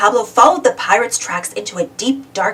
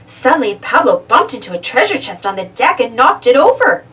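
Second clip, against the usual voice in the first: usual speaking voice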